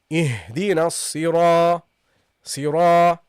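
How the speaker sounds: صراط with a dark long "raa", stopping before the ط; heavy prolonged ra. Shown as a demonstration. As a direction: hold the heavy ra in الصراط. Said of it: "it should be pronounced heavily".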